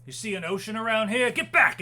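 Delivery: guard challenging an intruder, building to a high, loud shout; gruff order. Acted. Guard warns a prisoner back with a spear, angry rising shout. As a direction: sneer the question, then shout the command.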